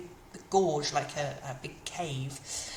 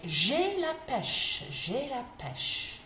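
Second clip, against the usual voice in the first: perky and upbeat; 5 semitones higher, much slower than usual. Shown as a demonstration. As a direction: say "J'ai la pêche" bright and full of energy, slowly. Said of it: "in French you say".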